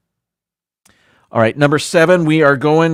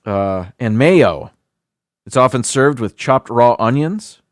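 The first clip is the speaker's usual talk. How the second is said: ordinary delivery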